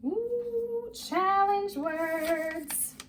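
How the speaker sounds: high, drawn-out "ooh" announcement; playful mock awe. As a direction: stretch it out high, teasing the kids. Teacher playfully announces harder words.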